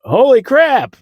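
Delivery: very high, exaggerated shock; mock amazement. ER doctors learning of a motorcycle crash, mock amazed. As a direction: imitate an awestruck doctor, voice shooting up.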